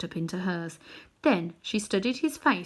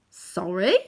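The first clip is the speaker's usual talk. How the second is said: exclaimed; 5 dB louder than usual. A narrator's reading. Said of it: "exclaimed Diggory".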